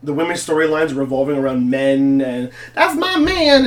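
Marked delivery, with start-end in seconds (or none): 2.7-3.7 mock girly voice. Mocking women's storylines.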